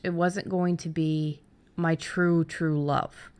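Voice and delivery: usual speaking voice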